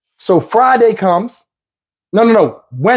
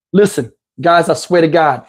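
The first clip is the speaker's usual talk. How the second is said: normal talking voice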